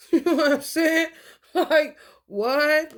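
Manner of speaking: laughing loud and high. Real, not acted. Laughing to viewers about the man's cooking request, loud and high. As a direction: burst out laughing, pitch way up. Laughing at her own story.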